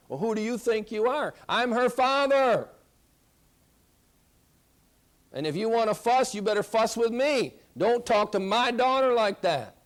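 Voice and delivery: a protective father firmly telling his wife off; stern husband. Acted. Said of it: "should you really say it like that?".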